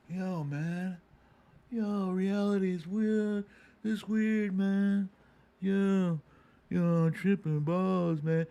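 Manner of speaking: stoned guy. Retold or imitated. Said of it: "anybody else who sees you".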